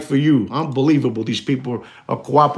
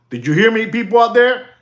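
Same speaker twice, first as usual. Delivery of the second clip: high, raised call to the audience; 9 semitones higher, 5 dB louder than usual. Real, not acted. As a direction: call out to everyone listening. Calling out to the audience.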